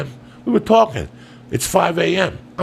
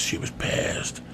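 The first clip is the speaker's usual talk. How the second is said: whispered and hushed; 9 dB quieter, slower than usual. Retold or imitated. Telling the listeners how angry mom was, whispered. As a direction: drop to a breathy whisper for effect.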